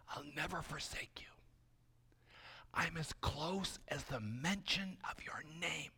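soft, breath-light divine promise; whispered reassurance. Acted. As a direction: reassure someone close in a hush. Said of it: "God whispers".

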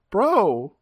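high, stammering confusion; acted bewilderment. Bystander suddenly yelled at.

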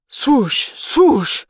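loud, high rushing swoosh noises; jet swoosh. A narrator's reading. Narrator voicing two jets rushing past, loud swooshes. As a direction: whoosh it like jets blowing past.

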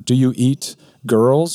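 put-on slow, a touch of fear in a girl's voice; timid question. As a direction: ask it slowly, fearing the answer. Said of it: "she said".

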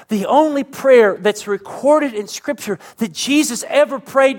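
high, loud preacher's crescendo; impassioned preaching. Real, not acted. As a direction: preach it at full force, pitched high. Preaches at full force.

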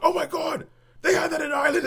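put-on thrilled at recognizing a childhood brand; excited amazement. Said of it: "and I was kinda like".

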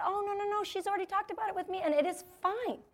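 lightly voiced husband waving away worries; dismissive, it's-all-handled reassurance; breezy reassurance. Breezy husband brushing off worried colleagues.

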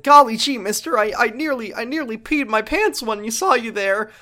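high, loud, wide-eyed hokey voice. Retold or imitated. Hiding punk greets Kiryu in high hokey golly-gee voice. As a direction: gush like a startled cartoon kid. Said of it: "he's like".

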